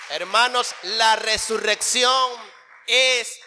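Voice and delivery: triumphant sermon close; a soaring pulpit finale; rising preaching. Acted. Closing preaching.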